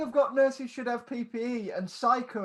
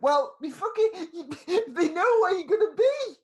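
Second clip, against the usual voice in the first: higher, louder, laughing outburst; mock exasperated shouting; 8 semitones higher, 7 dB louder than usual. Mocking mates who ask where Gary lives, exasperated. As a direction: act exasperated at their idiocy. Acting out online gang exchange.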